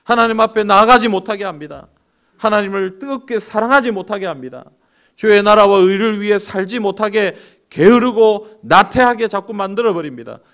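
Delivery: loud, high preaching climax; fervent preaching. Acted. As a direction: drive the warning home. Preaching at full force.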